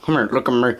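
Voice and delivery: usual speaking voice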